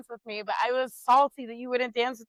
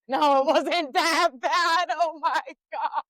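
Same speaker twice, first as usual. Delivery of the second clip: normal talking voice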